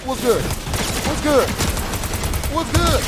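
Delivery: high, loud hollering of a friend; imitated calling out. Homeboy hailing his friend at the bar, imitated holler. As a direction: call out in rising bursts, imitating a buddy.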